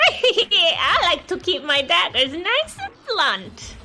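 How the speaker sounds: slow high goblin rasp; witchy goblin voice. Shown as a demonstration. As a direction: drag the line out like a little hag. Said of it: "give me a line".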